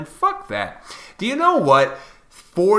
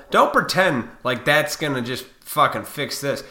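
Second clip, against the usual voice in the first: ordinary talk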